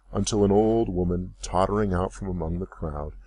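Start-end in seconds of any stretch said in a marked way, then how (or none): none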